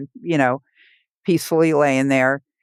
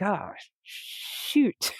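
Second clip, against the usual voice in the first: whispered oh-no to oneself; soft, whispery retold inner panic; hushed dismay; 5 semitones higher, 9 dB quieter than usual. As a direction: say it under your breath, mostly air. Dismay at having to go alone.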